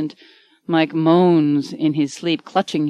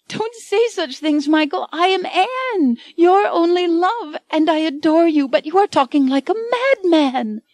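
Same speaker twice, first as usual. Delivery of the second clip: much higher, near-crying heroine voice; tearful Anne; 11 semitones higher than usual. A narrator's reading. Anne pleading with Michael that she is real, high and tearful. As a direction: plead with him through tears.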